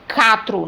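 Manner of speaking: CATRO, quatro wrongly said with plain k; U dropped in CATRO. Shown as a demonstration. Teacher showing what is not said, CATRO without U. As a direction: say CATRO with no w after the k. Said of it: "eu também não falo".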